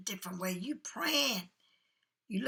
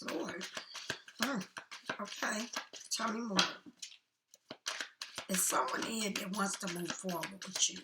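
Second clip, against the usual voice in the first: normal talking voice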